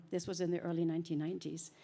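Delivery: normal talking voice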